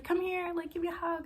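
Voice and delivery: lifted, sweet voice calling her in; high cheerful come-here; high sweet invitation. Retold or imitated. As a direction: imitate a bubbly host inviting a hug. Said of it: "She was like".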